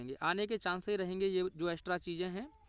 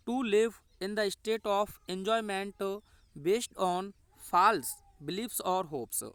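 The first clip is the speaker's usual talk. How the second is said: very slow, word by word, for students to write; slow dictation; 3 dB louder, much slower than usual. Shown as a demonstration. Teacher dictates answer to students, slow and deliberate. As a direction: read it out slowly as a teacher dictating.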